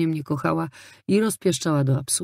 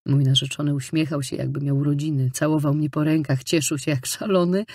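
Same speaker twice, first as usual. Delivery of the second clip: ordinary delivery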